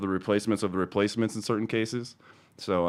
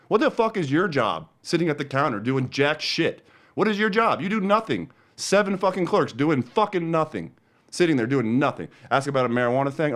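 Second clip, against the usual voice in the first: screaming rant; 6 semitones higher than usual. Real, not acted. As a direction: scream at the useless clerks. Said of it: "me screaming into a microphone".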